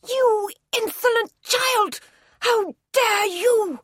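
very high, loud, slow enraged cry; furious shriek. A narrator's reading. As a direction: scream it at the child in fury. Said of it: "flew at her and shook her".

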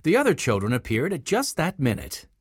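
usual speaking voice

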